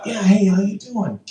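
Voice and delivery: high-pitched, badly faked presidential voice; cartoonish celebrity hello, pitched well up; terrible Trump impression. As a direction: greet a caller in a hammed-up impression. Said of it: "That's a terrible impression".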